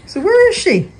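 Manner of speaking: high cooing question. Real, not acted. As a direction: ask the dogs in a sing-song high voice. Talking to the dogs.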